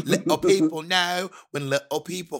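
sung Cockney urchin, high and drawn out; Cockney accent. Shown as a demonstration. Quoting Gavroche from Les Mis, Cockney accent. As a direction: sing it out in stage Cockney. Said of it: "I remember a Cockney accent".

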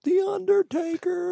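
very high, drawn-out wail; eerie falsetto ring introduction; Paul Bearer. Retold or imitated. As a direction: wail the name slowly like a wrestling manager. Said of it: "he'd be like".

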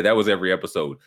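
normal talking voice

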